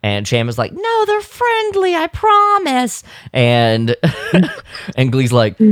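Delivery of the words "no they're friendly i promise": very high, drawn-out falsetto; falsetto mock plea. Host playing Cham reassuring Glee, mock falsetto plea. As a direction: plead slowly in a squeaky-high mock voice.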